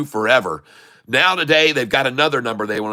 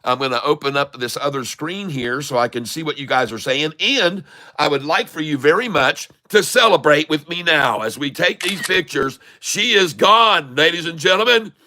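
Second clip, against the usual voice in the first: ordinary talk, nothing marked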